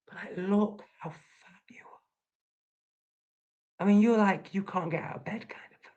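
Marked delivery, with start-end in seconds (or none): 0.1-2.0 a sneering aside under the breath; mocking whisper. Real, not acted. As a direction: whisper it with contempt. Insulting a commenter.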